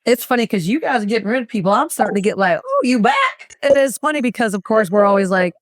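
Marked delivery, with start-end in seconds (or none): 2.7-3.5 mock surprise. Teasing a returning client.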